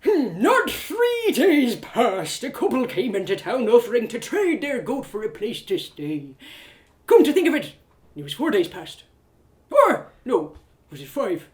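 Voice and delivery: put-on rambling old innkeep thinking aloud; slow start, dithering and unhurried; maddeningly slow. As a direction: take your time recalling, drifting off. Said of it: "Why was he talking so slow?".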